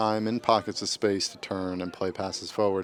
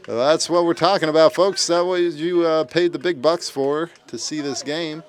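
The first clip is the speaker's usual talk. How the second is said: louder, higher sports commentary; excited goal call; 4 semitones higher, 6 dB louder than usual. Real, not acted. Commentator announces another goal to the fans, excited. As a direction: let the voice lift as the goal goes in.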